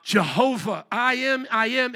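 slow, loud, thundering declaration; Jesus proclaiming. Acted. Jesus revealing who he is, loud proclamation. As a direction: proclaim it at full voice, drawing out each word. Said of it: "Jesus answered".

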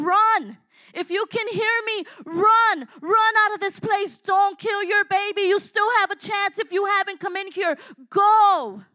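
high, very loud desperate scream; screaming warning. Retold or imitated. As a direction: scream at every woman to run. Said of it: "I started screaming".

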